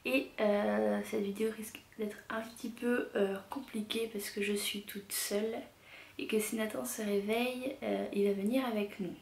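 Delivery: speaking softly. Real, not acted. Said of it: "si je parle pas trop fort".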